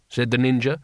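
usual speaking voice

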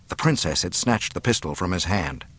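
usual speaking voice

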